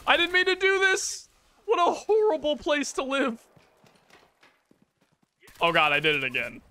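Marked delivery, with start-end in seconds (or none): 0.1-3.4 very high, put-on wailing voice; high-pitched wail. Gamer lamenting a horrible place to live, high-pitched wail. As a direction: wail it at the top of your range. Mock panic amid game combat.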